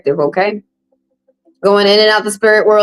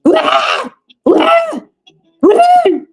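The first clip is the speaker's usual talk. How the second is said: repeated whiny "When?", pitched much higher and rising; nagging client; 14 semitones higher, much slower than usual. Retold or imitated. Tarot reader mocking a client nagging spirits, high whiny. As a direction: pester the spirits like an impatient client. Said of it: "you keep saying to the spirit".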